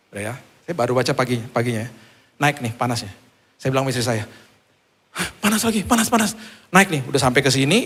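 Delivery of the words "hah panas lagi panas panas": high alarmed gasp of words; retold alarm, pitched up. Retold alarm at fever returning.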